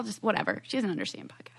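soft conspiratorial whisper. Real, not acted. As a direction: keep it hushed and secret. Keeps whispering the secret.

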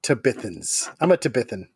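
Tabithans and Tabithan with BITH stressed; stress on second syllable. Shown as a demonstration. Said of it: "put the emphasis on a different syllable".

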